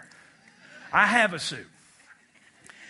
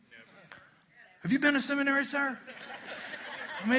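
normal talking voice

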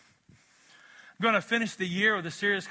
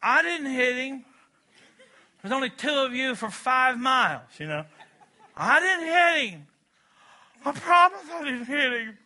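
higher, louder, protesting big brother; defensive kid; 7 semitones higher, 6 dB louder than usual. Retold or imitated. Older brother denying to the parent, high pleading. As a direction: insist you're innocent, whiny. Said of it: "what would the ten-year-old do?".

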